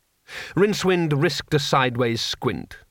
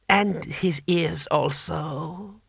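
put-on slow, drawn-out old voice; ancient emperor; 3 semitones higher, 3 dB quieter, much slower than usual. Emperor adding to the sentence, slow old voice. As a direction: stretch the order out feebly.

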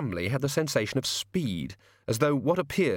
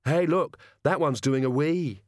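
high-pitched excited little sister; little girl; 4 semitones higher than usual. Acted. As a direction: pipe up like a delighted child. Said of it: "His sister said".

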